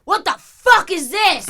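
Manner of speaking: high, loud startled snarl; angry yell. Retold or imitated. Homeless woman woken by a bag, snapping loud and high. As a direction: shout it like she was just woken up. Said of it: "she's like".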